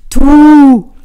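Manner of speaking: long, high, very loud hoot; playful call. A narrator's reading. Trini calls to Line ahead on the ice, playful shout. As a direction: holler it playfully, drawn out. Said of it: "rief sie spielerisch".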